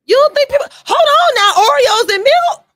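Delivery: high, loud incredulous challenge; loud disbelief. Real, not acted. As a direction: halt the talk in stunned disbelief, voice shooting up. Disbelief that nobody dips Oreos in milk.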